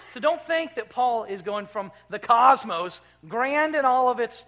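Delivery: fervent sermon build; preaching at full force. Acted. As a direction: lift the room with the glory of it. Preaching climax on Christ's lordship.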